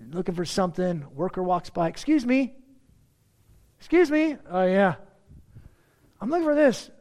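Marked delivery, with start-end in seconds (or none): 1.9-6.9 higher and louder, flagging someone down; calling out. Retold or imitated. Customer chasing a worker in the aisle, calling out loudly. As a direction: call after the worker walking by.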